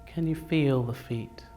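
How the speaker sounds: guided meditation. Acted. Leading seated group through a body scan, calm guided meditation. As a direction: guide them unhurriedly, letting each phrase settle.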